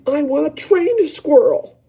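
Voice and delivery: high, loud, slow demand; Veruca shouting. Acted. Veruca shouting her demand at her mother, high and loud. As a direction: bellow it like a spoilt brat. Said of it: "Veruca shouted".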